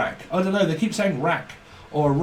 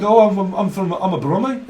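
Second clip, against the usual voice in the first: louder, a touch higher mock Birmingham voice; Brummie accent; 4 semitones higher, 5 dB louder than usual. Lad says he's a Brummie, light mock Brummie accent. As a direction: proudly claim Birmingham in a put-on Brummie accent. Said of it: "it's Brummy".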